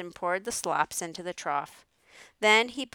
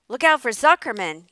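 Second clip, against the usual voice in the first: loud, raised warning yell; yelling gander; 4 semitones higher, 11 dB louder than usual. A narrator's reading. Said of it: "yelled the gander".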